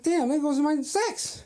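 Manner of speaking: high, drawn-out mock lament; mock dismay. Husband after wife walks off, high mock dismay. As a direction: wail it in comic disappointment.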